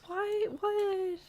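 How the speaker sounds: very high-pitched drawn-out questioning; mock bewildered squeal. Streamer reacts to sim upset about triplets, high mock squeal.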